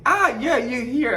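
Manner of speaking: light up as if the lost guy finally showed up; surprised recognition. Retold or imitated. Housemate finds lost Victor at the door, delighted surprise.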